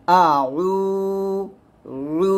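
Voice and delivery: pressed throat sound held for one beat; stretched ain vowel. Shown as a demonstration. Teacher demonstrating the pressed ain with its stretch. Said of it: "আইনটা চাপ দিয়ে বলতে হবে এবং এক লিপি পরিমাণ টান".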